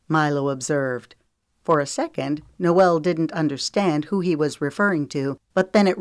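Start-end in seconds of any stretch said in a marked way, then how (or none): none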